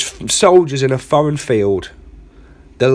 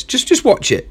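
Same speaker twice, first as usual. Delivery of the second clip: higher, pushy insistence; insistent urging; 10 semitones higher than usual. Acted. Caller urges the Pentagon official to watch the link, insistent. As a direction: push him to just watch it.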